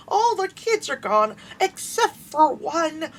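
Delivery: put-on old lady voice. The old lady telling the group about the last kid, high slow frail voice. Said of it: "they're like".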